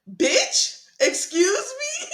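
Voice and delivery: high, stretched mock-offended question; incredulous outrage. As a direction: act out disbelief, breaking into laughter. Acting out outrage at his reply.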